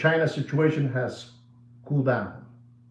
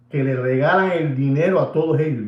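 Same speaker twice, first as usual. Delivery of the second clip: normal talking voice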